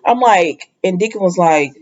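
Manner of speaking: ordinary delivery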